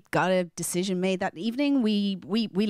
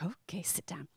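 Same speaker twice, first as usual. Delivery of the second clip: much softer whispered agreement; 2 semitones lower, 14 dB quieter than usual. Retold or imitated. Mother answering her little son, soft whisper. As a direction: hush and give in gently.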